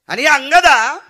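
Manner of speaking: high, slow, ringing declaration; proclaimed promise. Acted. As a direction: proclaim the gift loudly and slowly. Acting Ram's promise to Angad.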